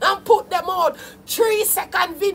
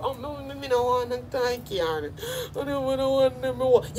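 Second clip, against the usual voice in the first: much softer, lower put-on whimper; mock whiny artist; 4 semitones lower, 9 dB quieter than usual. Ridiculing the artist's complaint, soft sulky mock whimper. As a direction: mimic him as a sulky child who wants no toy car. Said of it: "You sound so dumb".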